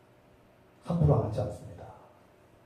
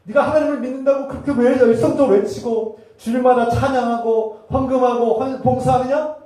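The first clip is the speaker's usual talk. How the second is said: high, loud accusing question; impassioned rebuke; 10 semitones higher, 9 dB louder than usual. Retold or imitated. Jesus challenging a zealous churchgoer, loud impassioned rebuke. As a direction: hurl the challenge at full preaching force. Acted challenge to hypocritical believer.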